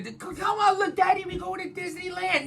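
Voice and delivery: put-on high, soft kid voice; pleading child. Kid begging Daddy for a Disneyland trip, high mock child voice.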